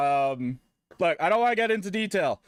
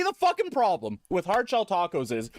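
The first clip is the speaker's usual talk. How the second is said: ordinary talk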